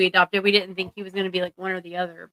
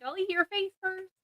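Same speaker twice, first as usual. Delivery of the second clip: cat voice; 11 semitones higher, 11 dB quieter than usual. Retold or imitated. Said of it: "She's like".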